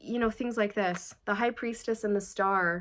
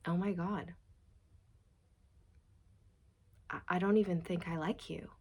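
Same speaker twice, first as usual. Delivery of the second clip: mild quiet disbelief; 2 semitones lower, 7 dB quieter than usual. Retold or imitated. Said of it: "looking at their spouse going".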